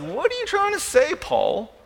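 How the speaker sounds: high-pitched baffled question, dropping on the name; mock incredulous. Preacher voicing readers puzzled by Paul's greeting, incredulous.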